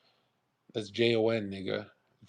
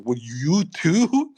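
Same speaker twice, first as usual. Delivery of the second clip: stretched-out, higher incredulous question; slow disbelief; 4 semitones higher, 4 dB louder, much slower than usual. Retold or imitated. Retelling a friend's warning, slow stunned disbelief. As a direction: drag it out in disbelief. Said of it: "And I was like".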